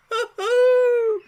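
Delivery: very high, loud gleeful hoot; ecstatic hoot. Acted. Toad hooting in self-admiration, very high and loud. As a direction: whoop with delight. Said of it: "he cried in ecstasies".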